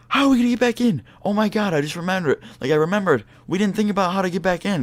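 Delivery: high-pitched mock panic, not truly shouted; loud panicked retelling. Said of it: "he was, like, really loud".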